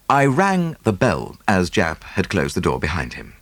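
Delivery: ordinary delivery, nothing marked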